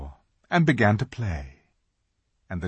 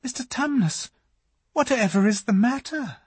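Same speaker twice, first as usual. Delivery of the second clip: put-on high, worried girl's question; concerned; 13 semitones higher than usual. A narrator's reading. Lucy seeing the fawn's tears, worried. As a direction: ask with sudden worry.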